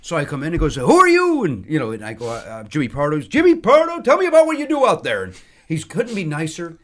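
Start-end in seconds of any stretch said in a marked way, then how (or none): 0.8-1.4 loud, high, hearty demand; imitated exuberant bellow; booming greeting. Mel Brooks greets him. 3.2-5.2 loud, high, exuberant echo; hammed-up hearty star greeting; booming showman. Mel Brooks booms his name back.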